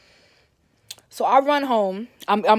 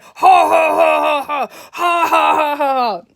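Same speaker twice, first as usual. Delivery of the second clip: loud hearty imitated laugh, pitched up; big booming laugh; 6 semitones higher, 9 dB louder, slower than usual. Niece imitating her uncle's hard laugh, loud and hearty. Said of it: "he had this laugh".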